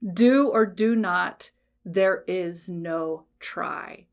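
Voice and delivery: very slow, weighty delivery in own voice; deliberate quotation. Retold or imitated. Coach quoting Yoda without doing the voice, deliberate quotation. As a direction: spell out each phrase slowly, starting firm. Quotes famous line without the voice.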